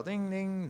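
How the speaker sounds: high-pitched mimicked doorbell ding; doorbell imitation. Athlete retelling a doorbell at dawn, high mimicked chime. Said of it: "ringing the doorbell".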